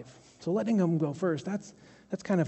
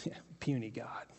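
whispered private sneer; 5 semitones lower, 13 dB quieter than usual. Retold or imitated. Elijah thinking while watching the prophets, whispered scorn. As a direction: breathe it under your breath.

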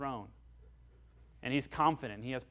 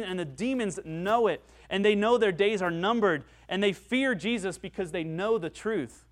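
loud, higher proclamation; preaching at full force; 6 semitones higher, 7 dB louder than usual. Acted. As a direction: proclaim Jesus as king to the church.

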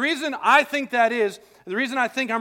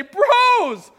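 high, loud gushing welcome; mock joyful reunion; 12 semitones higher, 10 dB louder than usual. Pastor mocks a gushing Joseph greeting brothers, high and loud. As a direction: burst out like an overjoyed long-lost brother. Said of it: "He doesn't run out to them and say".

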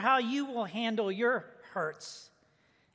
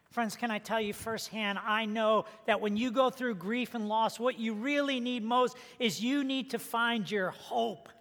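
ordinary talk

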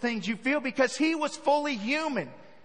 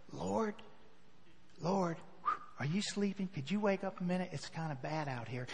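timid soft plea; 8 semitones lower, 15 dB quieter than usual. Pretend. Disciples timidly waking Jesus.

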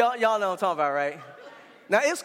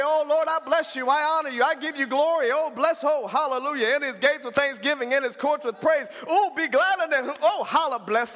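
showy string of praise phrases; mock church praise; 4 semitones higher than usual. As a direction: parody a worshipper rattling off hallelujahs. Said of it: "You don't need to get on your knees and say".

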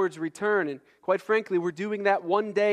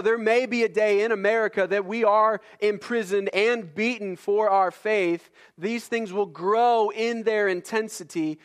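higher, insistent preacher's push; full-force preaching; 5 semitones higher, 4 dB louder than usual. Acted. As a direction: press the point like a pulpit sermon.

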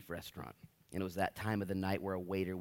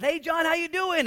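loud, high greeting shouted across the street; calling out; 12 dB louder than usual. Retold or imitated. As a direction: call out to a friend riding past. Pastor calling out to passing John.

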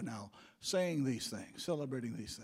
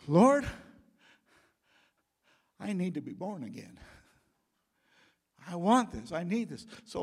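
earnest, raised prayer voice; 2 semitones higher, 5 dB louder than usual. Acted. Preacher leading the sinner's prayer aloud, earnest. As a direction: cry out to God as you pray.

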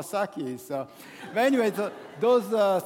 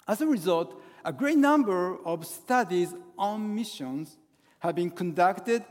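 ordinary delivery

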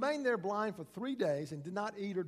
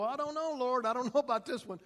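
doubtful whine; 6 semitones higher, 5 dB louder than usual. Retold or imitated. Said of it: "We go".

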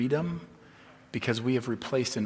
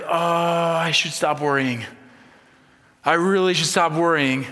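loud, drawn-out mock fretting; self-scolding worrier; 3 semitones higher, 7 dB louder than usual. Preacher mimicking a worrier scolding himself, loud exasperated fretting. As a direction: groan it out as a hammed-up worrier.

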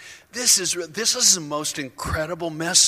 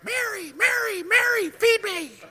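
put-on very high, squeaky baby voice calling; crying baby; 5 dB louder than usual. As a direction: whine for Mary like a hungry infant. Baby Jesus calling his mother.

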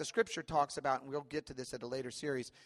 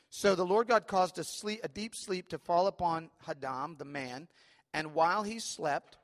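scripture reading; 3 semitones higher, 4 dB louder than usual. Acted. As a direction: lift the verse out like a proclamation. Reads scripture aloud.

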